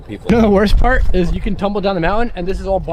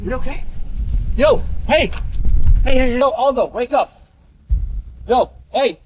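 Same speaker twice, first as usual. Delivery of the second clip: frantic, high-pitched worry; panicked; 7 semitones higher than usual. Real, not acted. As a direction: shake him awake, scared. Waking a fallen friend.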